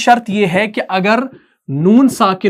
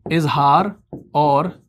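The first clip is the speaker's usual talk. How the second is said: measured, lowered voice for note-taking; slow dictation; 4 semitones lower, 4 dB quieter, slower than usual. Shown as a demonstration. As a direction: separate words with very long pauses. Dictating for students to write.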